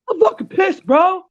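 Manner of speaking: very high squeaky mock outrage; high mocking whine. Mocking Glaire to a friend, very high whiny mock rage. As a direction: sneer it out in a high cartoon voice. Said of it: "And Glaire's like".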